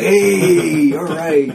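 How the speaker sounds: high, laid-back stoner. Retold or imitated. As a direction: drawl it like a mellow stoner. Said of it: "she kinda talked like a stoner".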